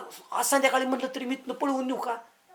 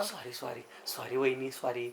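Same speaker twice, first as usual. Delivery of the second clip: low, very soft sorry; meek apology; 12 semitones lower, 16 dB quieter, slower than usual. Acted. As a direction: back down in a small, low murmur. Meekly apologising after being scolded. (नरमून हळू आवाजात माफी)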